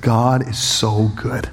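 slow, heartfelt declaration; understated emotion, very drawn out; retold tearful praise. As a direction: declare it slowly, savouring each word. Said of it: "as I'm bawling".

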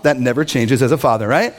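usual speaking voice

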